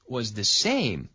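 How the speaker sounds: usual speaking voice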